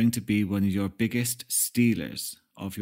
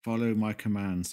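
mock mesmerizing hypnotist voice; lightly playing a hypnotist; hypnotic voice. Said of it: "Hear my hypnotic voice".

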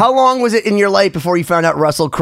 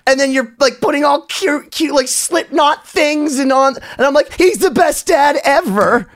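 gushing; 9 semitones higher than usual. Real, not acted. Gushing over his baby pictures.

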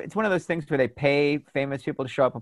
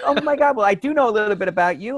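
starstruck; 6 semitones higher than usual. Retold or imitated. Retells starstruck reaction to Capriati.

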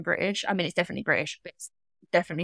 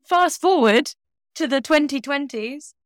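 higher, louder, slow mock-epic build; mock grand narration; 6 semitones higher, 4 dB louder, slower than usual. Cohost pitches coffee donations as a mock-epic, higher and louder. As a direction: narrate it like a movie trailer. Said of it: "grand storyteller voice".

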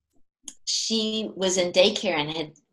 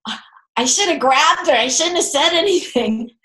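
higher, louder, frustrated self-reproach; a mom's frustrated inner thought, higher and louder; exasperated inner thought; 6 semitones higher, 5 dB louder than usual. Retold or imitated. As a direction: scold yourself as the moment replays. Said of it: "And I thought".

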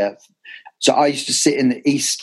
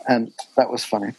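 usual speaking voice

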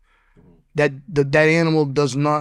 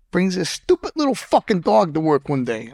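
usual speaking voice

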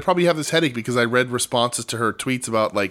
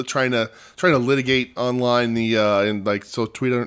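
usual speaking voice

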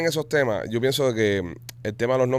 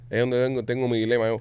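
normal talking voice

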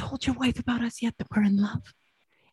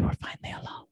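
breathy secret whisper; 9 dB quieter than usual. Acted. Secret lovers alone together.

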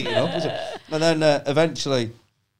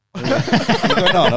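confused outburst; 8 semitones higher, 7 dB louder, much slower than usual. Retold or imitated. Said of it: "and I went".

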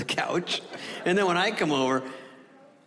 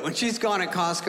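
normal talking voice

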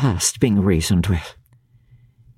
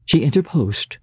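plain reading voice

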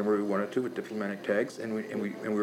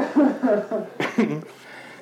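high laugh; 10 semitones higher, 4 dB louder than usual. Real, not acted. Laughing at own war story.

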